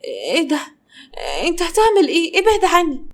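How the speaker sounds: startled, pitch jumping high; frightened protest, voice leaping up; shocked. Acted. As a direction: pull away from him in alarm. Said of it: "بصت له بصدمة".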